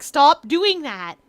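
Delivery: loud, slow mock scolding; mad staff. Aquarium staff scolding the creature that slips away, mock angry. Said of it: "always gets mad and is like".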